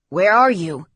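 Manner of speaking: loud, brisk demand; urgent question. A narrator's reading. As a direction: demand the location at once. Father rushing out, urgent question.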